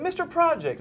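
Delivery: put-on high, piping little boy voice; little kid voice. Host mimics a kid on the street, high little boy voice. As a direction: squeak it like a small child tugging a shirt. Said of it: "And he said".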